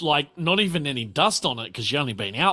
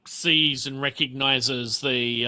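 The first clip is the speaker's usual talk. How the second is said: normal talking voice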